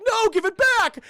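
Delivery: high, loud cartoon cry; panicked yell. Dog yelling at a pie thief, panicked yell.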